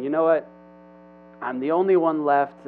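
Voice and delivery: loud, acted venting; mock complaint with a forceful push; complaining. Elijah venting to God, acted complaining. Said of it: "he's kind of complaining to God".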